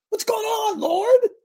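high, loud cry of complaint that breaks into a shout on Lord; anguished cry. Acted. Preacher voicing Habakkuk crying out to God, anguished shout. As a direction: plead upward at God, almost shouting.

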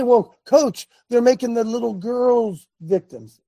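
mock the caller's protest; objecting listener voice. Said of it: "You say".

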